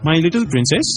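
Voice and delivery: high, cooing endearment; doting father. Acted. Father returns with a gift for his daughter, doting coo. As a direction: coo fondly to your small daughter.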